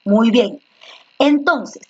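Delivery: ordinary delivery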